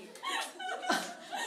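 high, squealing laugh; cracking up mid-story; high giggle fit; burst of laughter. Real, not acted. Laughing at roommate's excuse.

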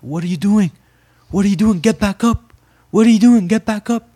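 high, loud imitated screech; screaming younger sister. Younger sister yelling at a knocked-down boxer, screaming. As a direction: imitate your sister yelling frantically from the crowd. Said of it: "I heard my younger sister screaming".

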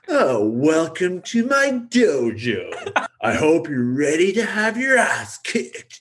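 cringy villain greeting a new student, smarmy and slow; smarmy John Kreese. Retold or imitated. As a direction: ham up the cheesy bad guy welcoming you to his dojo. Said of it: "you know, real smarmy".

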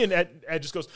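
ordinary delivery

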